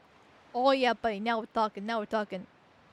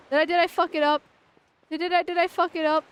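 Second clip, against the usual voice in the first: alarmed; 3 semitones higher, 6 dB louder than usual. Real, not acted. Gamer fears botched quest.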